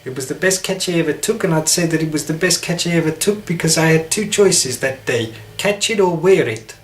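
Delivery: brisk South African sportsman's talk; South African accent. Shown as a demonstration. Demonstrating with cricket talk, clipped South African accent. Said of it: "as good as I could do with South African".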